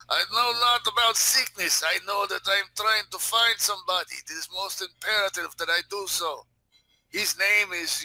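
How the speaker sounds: high, insistent character voice; dismissive Russian. Pretend. Russian answering the sergeant's sickness charge, dismissive. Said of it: "real dismissive".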